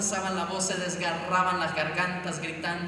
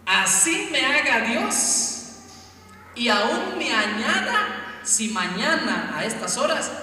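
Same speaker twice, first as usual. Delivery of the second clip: higher, louder and slow menacing vow; evil threat; 6 semitones higher, 7 dB louder, slower than usual. Acted. Jezabel vows to kill Elías, slow and menacing. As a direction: spit out a wicked queen's threat. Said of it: "Es una amenaza".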